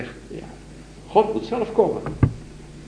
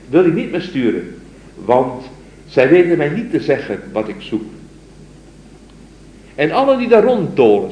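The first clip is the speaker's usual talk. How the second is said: poem recital; 5 semitones higher, 5 dB louder than usual. Acted. Lecturer reciting the mystic's poem to his students, solemn recital. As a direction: recite it like a devoted lover's plea.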